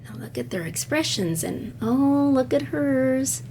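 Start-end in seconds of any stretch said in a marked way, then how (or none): none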